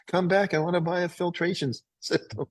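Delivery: mild pretend yell, easing off at the end; mock yell. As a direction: imitate an eager customer calling after someone, half-hearted. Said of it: "chase you down the street with a check and yell".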